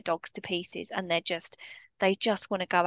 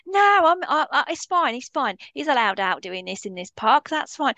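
mock-breezy brush-off, pitched much higher and louder; dismissive owner mimicry; 5 semitones higher, 4 dB louder than usual. Trainer mimicking an off-lead owner brushing off others, mock-breezy. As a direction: ham up a careless owner waving off a complaint.

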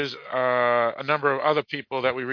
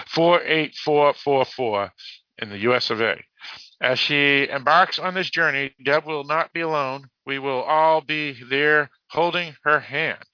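higher, measured read-aloud; deliberate announcement delivery; reading a post aloud; 4 semitones higher, 4 dB louder than usual. Real, not acted. Reads a posted message.

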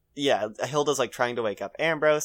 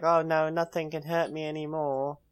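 higher imitated child voice; weird little boy; 4 semitones higher than usual. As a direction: play a small boy, lightly put on. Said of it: "the little weird boy's there".

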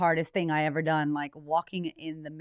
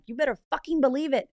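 defiant retort; 7 semitones higher, faster than usual. Retold or imitated. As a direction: fire it back at him, daring. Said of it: "I'm like".

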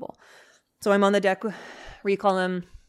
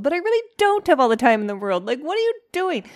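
louder and much higher, rising to a squeal; exasperated outburst; 10 semitones higher, 8 dB louder than usual. Real, not acted. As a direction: blurt out your impatience. Exasperated at the dawdling dog.

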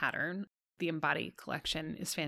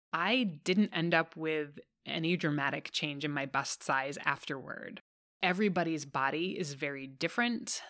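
normal talking voice

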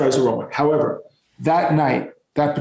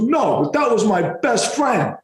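slow, higher New York wiseguy; imitated New York voice, drawn out; New York Sopranos voice; 7 semitones higher, slower than usual. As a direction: lightly mimic an emphatic New York guy. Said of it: "voice is one of these New York".

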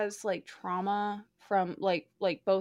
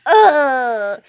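loud fed-up ugh; exasperated groan; 3 semitones higher, 17 dB louder than usual. Retold or imitated. Reader groaning at every book, fed-up ugh. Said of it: "I'm like".

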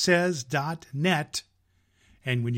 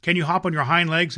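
asked; 3 semitones higher, 6 dB louder, faster than usual. A narrator's reading. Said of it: "asked the furry rabbit".

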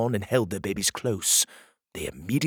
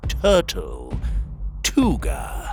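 dramatic reveal; 6 semitones lower, much slower than usual. A narrator's reading.

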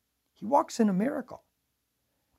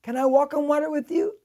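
Peter pleading; 7 semitones higher, 6 dB louder than usual. Retold or imitated. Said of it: "And he says".

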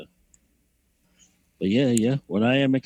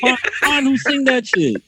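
laughing. Real, not acted. Laughs at song.

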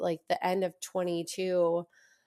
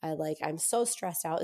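usual speaking voice

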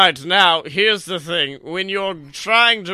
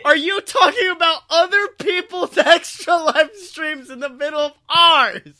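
mock outrage; 8 semitones higher, 4 dB louder than usual. Real, not acted. Co-host mentions rival streams.

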